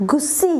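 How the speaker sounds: Gucci with an s, 'goo-see'; wrong pronunciation. Shown as a demonstration. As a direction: read Gucci as 'gussi'. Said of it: "but not at all". (Gucciનો ખોટો ઉચ્ચાર, 'ગુસી')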